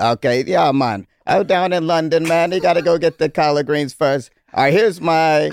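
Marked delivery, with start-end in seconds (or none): 0.0-4.3 badly faked Jamaican lilt; Jamaican accent. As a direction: wobbly attempt at a Jamaican accent. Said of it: "I'm just gonna do a Jamaican accent".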